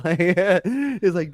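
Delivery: laughing mid-sentence. Real, not acted. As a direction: talk through a laugh. Laughs while retelling a friend.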